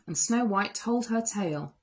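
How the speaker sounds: plain reading voice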